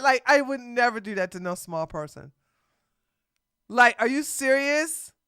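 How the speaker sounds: ordinary delivery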